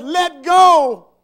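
very loud, high command; booming acted order; commanding shout. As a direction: thunder it down from heaven. Heaven's voice commands, story climax.

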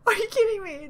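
gasping, loud disbelief; can't believe what she's seeing; overwhelmed disbelief. Real, not acted. Overwhelmed fan reacting to video.

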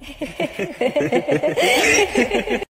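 long, taunting cackle; creepy laugh. Acted. Apparition mocks frightened girl, creepy cackle. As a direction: cackle on and on, rising.

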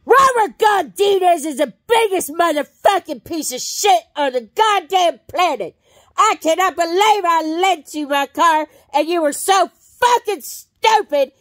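full-throated screaming fury for the voice scanner; performed anger. Shown as a demonstration. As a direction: rage at him for wrecking the lent car. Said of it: "what anger does".

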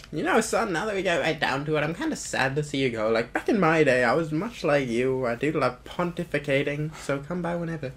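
folksy elder saying farewell; rambling elder. Acted. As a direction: reminisce fondly to a youngster. Voices elder character in game.

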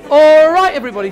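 loud high call to a room. Real, not acted. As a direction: shout over the chatter. Calling the room to order.

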